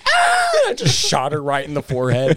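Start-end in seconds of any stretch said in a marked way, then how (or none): 0.1-0.5 high, loud startled shriek; kid's scream. Retold or imitated. Dad imitates his daughter shrieking before the Nerf shot, high and loud. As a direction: shriek like a kid caught hiding. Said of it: "she's like".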